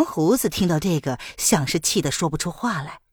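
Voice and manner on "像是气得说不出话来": ordinary delivery, nothing marked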